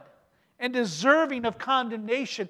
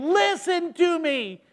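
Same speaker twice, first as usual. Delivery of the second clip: put-on parent to child; 6 semitones higher, slower than usual. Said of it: "putting your face in his hands".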